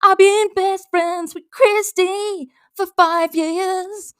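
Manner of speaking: high, loud, slow mocking voice; mocking Katie. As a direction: parody her smug boast slowly. Mocking Katie's post in her voice.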